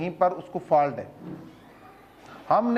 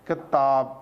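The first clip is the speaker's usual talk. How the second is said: کتاب said in one go, lower-pitched; joined-up word reading; 6 semitones lower, much slower than usual. Shown as a demonstration. Teacher showing parents a child's reading, joined up. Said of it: "ایک روحانی سے پڑھ رہا ہے".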